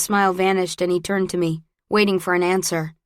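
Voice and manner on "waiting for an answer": plain reading voice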